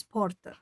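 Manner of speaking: "porta" with the rolled southern Portuguese R. Shown as a demonstration. Demonstrating a southern Portuguese accent to viewers, rolled R in "porta". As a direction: roll the R in "porta". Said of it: "rolled R".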